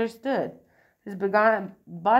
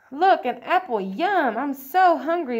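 higher, louder, hungry delight; 5 semitones higher, 6 dB louder than usual. A narrator's reading. Bully spots an apple on the floor, loud delight. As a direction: burst out with greedy delight. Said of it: "Benny says with delight".

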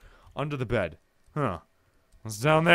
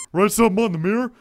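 put-on, slightly louder character voice; voice for Paul; 2 semitones higher, 5 dB louder than usual. Paul answering David about the mirror, put-on character voice. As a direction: give Paul his own put-on voice. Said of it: "That's gonna be his voice".

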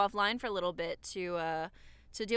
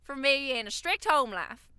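higher, exaggerated Southern twang; Southern accent; 4 semitones higher, 4 dB louder than usual. Shown as a demonstration. Reading a fan's Tinder backstory, hammed-up Southern accent. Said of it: "read it in a Southern accent".

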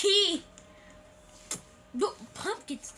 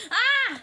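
short, loud, high shriek; startled scream; 8 semitones higher, 8 dB louder than usual. Real, not acted. Player walking into a trap, screaming. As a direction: yelp as if a trap springs.

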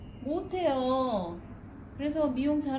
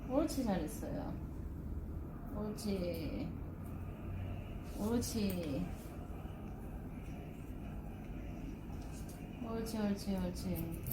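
soft praise to a dog; 3 semitones lower, 9 dB quieter than usual. Real, not acted. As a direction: soothe the dog softly while cutting. Praising dog during haircut. (강아지를 달래듯 조용히 칭찬)

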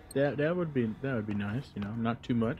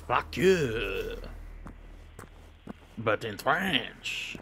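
mock French taunt; much slower than usual.

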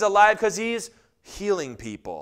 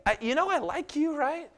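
God tender; 8 semitones higher than usual. Acted. God to a believer asking for healing, gentle and fond. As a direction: speak like a friend who just likes them. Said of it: "And God goes".

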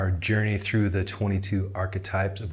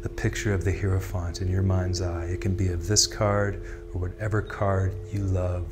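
guided meditation; 4 dB quieter than usual. Acted. Tarot guide leading listeners into breathing and imagery, calm guided meditation. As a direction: ease them gently down into stillness.

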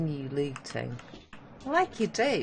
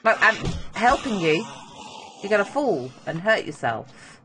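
louder, higher warning call to a creature; 7 semitones higher, 6 dB louder than usual. Acted. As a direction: call it back from the ledge.

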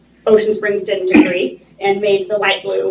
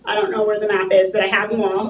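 ordinary talk, nothing marked

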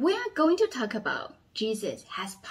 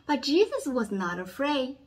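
dramatic storytelling. A narrator's reading. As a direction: lively voice for a children's Bible story.

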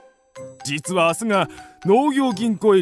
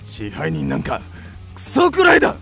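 indignant rant jumping up to a shout; angry outburst. Acted. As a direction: explode at the widow in rising temper. Creditor loses his temper at widow.

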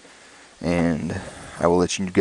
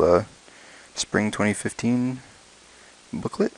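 ordinary talk, nothing marked

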